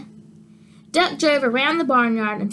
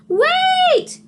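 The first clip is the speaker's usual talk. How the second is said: very high, loud cry; 5 dB louder than usual. Acted. Sheep calls after the leaving tractor, high loud cry. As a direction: call out before they leave you. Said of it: "cried Sheep".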